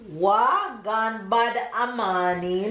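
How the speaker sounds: slow, careful patois phrase; Jamaican patois slowly. Shown as a demonstration. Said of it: "break it down for you so you can say it".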